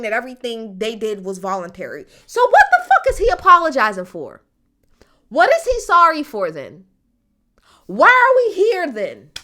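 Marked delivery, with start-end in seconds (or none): 2.2-9.3 loud, high-pitched exasperated questions; pissed off. Real, not acted. Commentator challenging an apology with no victims, pissed off. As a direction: demand an answer, raised and indignant. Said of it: "This is what's pissing me off so much".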